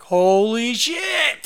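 high, loud, slow holy shit; shocked holy shit on seeing the trailer; excited shock. Retold or imitated. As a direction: stretch it out in amazement. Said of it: "and I was like".

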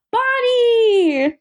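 high excited shout of a name; a happy squeal of the name; excited cheer. Real, not acted. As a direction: cheer her return. Cheering Bonnie's return.

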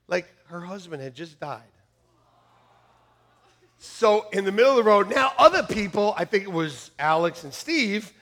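ordinary delivery

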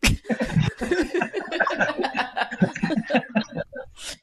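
breathy silent laughter; wheezy laugh. Real, not acted. Laughing at network refusal.

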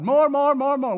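loud, high mock pleading; insistent demand. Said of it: "We're always telling God".

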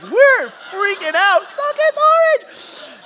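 high, loud, drawn-out squeal of excitement; freaked-out excitement. Retold or imitated. Preacher reliving boys' soda excitement, wildly excited shout. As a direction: stretch it out in a high, loud yell.

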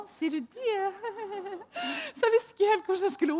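high, surprised laughing. Real, not acted. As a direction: break into laughter.

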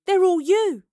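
high, loud exclamation; shocked realisation. Acted. Girl realising every double is one man, astonished. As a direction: burst out with it, pitch leaping.

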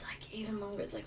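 whispered aside. Real, not acted. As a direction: whisper it under your breath. Aside about long flight.

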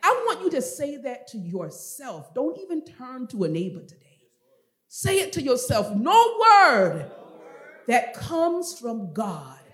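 slow, loud preaching with soaring key words; forceful preaching. Acted. Preacher driving a promise home to the congregation, forceful preaching. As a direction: hammer the verse phrase by phrase.

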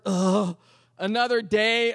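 slow, drawn-out weary dread; groan, then drag the words out; worn-out morning complaint; exhausted groan. Acted. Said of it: "have you ever felt exhausted".

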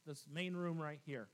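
ordinary delivery

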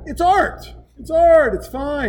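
mocking the man high and self-excusing; mock whiny creep.

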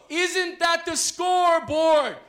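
very loud, high, slow cheer; cheerleader shout. Shown as a demonstration. Playing cheerleader, leads the audience in response, shouted chant. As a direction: bellow it slowly so the crowd answers. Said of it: "I'll be the cheerleader".

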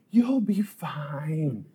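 slow, soft, airy reassurance; dismissive lady. Retold or imitated. Woman brushing off injured paperboy, dismissive. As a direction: soothe slowly and softly, unbothered. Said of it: "my dismissive lady".

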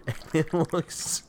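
words breaking into giggles; can't stop laughing while talking; laughing uncontrollably. Real, not acted. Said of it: "I can't control this".

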